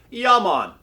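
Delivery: loud, high Jamaican shout; bad Jamaican accent, shouted. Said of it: "Terrible Jamaican accent".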